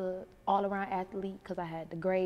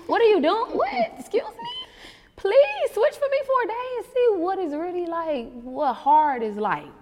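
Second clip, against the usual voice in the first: much higher and louder, sassy disbelief; incredulous retort; 12 semitones higher, 10 dB louder than usual. Retold or imitated. Mother answering people without kids, loud high incredulous sass. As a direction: snap back at complainers in disbelief.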